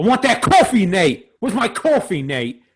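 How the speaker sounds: quoted character. Acted. Doing a reference for the group, hammed-up demand. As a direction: exclaim it high and loud like a famous quote. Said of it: "know that reference?".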